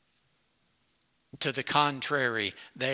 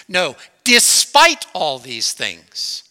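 emphatic proclamation; 10 dB louder, much slower than usual. Real, not acted. Climactic scripture proclamation.

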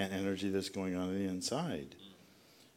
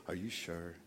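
mock concerned asking; 4 semitones lower, 10 dB quieter than usual. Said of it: "And then they say this".

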